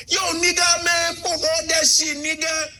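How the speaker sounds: high, shouted African-accent impression; hammed-up high African voice; Africans copying American slang. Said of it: "because even Africans are going".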